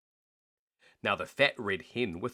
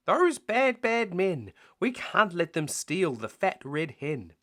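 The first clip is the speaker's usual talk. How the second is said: high, louder outraged cats; indignant cats; 7 semitones higher, 5 dB louder than usual. Acted. Cats to the animals, high outraged protest. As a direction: cry out in protest.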